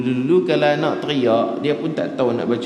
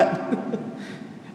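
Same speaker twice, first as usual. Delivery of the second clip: airy laugh; 10 semitones higher, 8 dB quieter than usual. Real, not acted. Laughs at own story.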